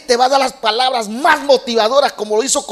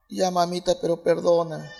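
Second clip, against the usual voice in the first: put-on soft, lower little boy begging mama; a small son pleading softly with his angry mother; pleading child; 4 semitones lower, 7 dB quieter than usual. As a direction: beg for forgiveness meekly. Son begs again.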